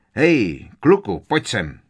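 put-on sharp, loud call to a boy; gruff acted summons. Uncle summoning his nephew after an incident, sharp loud call. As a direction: call him over as an angry uncle.

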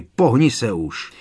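loud impatient shout at the stone gargoyle. Acted. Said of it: "křikl na něj Harry".

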